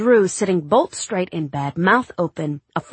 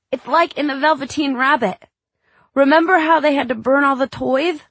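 put-on child voice; 9 semitones higher, 7 dB louder than usual. Young boy talking to dad.

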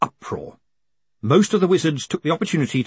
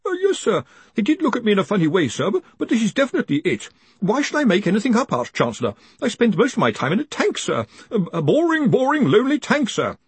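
high-pitched, flustered underling; anxious stammerer explaining himself to the boss; nervous young wizard; 10 semitones higher, faster than usual. A narrator's reading. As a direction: plead your honesty in a hurried, high voice.

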